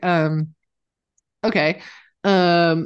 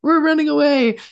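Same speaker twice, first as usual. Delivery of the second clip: panicked retreat; 14 semitones higher, 5 dB louder than usual. Retold or imitated. Backing away from a charging mother bear, high panicky voice. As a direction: squeak out a scared surrender. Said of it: "I was like".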